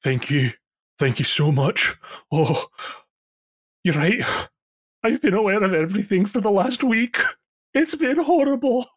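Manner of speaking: rising, high and pleading thanks; grateful dwarf. Acted. Freed frozen dwarf thanking his rescuers, high and desperate. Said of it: "he just says".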